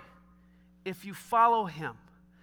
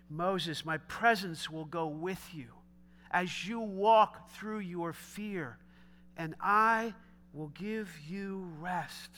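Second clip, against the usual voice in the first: slow soak-in repetition; 5 semitones lower, 6 dB quieter, slower than usual. Shown as a demonstration. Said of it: "I'm gonna say that again a couple times just so we can let it soak".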